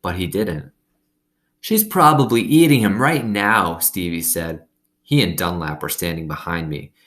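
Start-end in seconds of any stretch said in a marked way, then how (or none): 1.6-3.8 put-on boy teasing. A narrator's reading.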